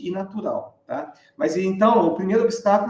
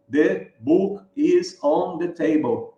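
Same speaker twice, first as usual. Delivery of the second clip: slow and jolting, each word separate; beginner's "The book is on the table", word by word; choppy word by word; much slower than usual. Shown as a demonstration. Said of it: "a gente fala no so-, solavanco". (aos solavancos, palavra por palavra)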